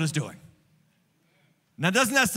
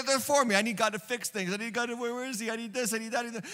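soft, whiny voice of a needy believer, much softer; mock the demanding me-first believer, quietly; 6 dB quieter, faster than usual. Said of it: "this is where a lot of Christians are".

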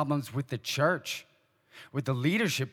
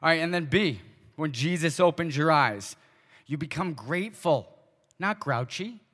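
ordinary talk

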